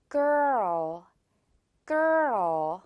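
put-on slow girl, R curl into L; two-beat girl. Shown as a demonstration. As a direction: let girl unfold in two beats. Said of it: "두 글자라고 내가 생각을 하면서". (girl을 두 박자로 천천히)

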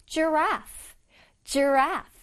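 "giraffe" slowly drawn out for learners. Shown as a demonstration. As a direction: stretch out "giraffe" like a long neck. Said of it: "천천히 발음해줘야 할".

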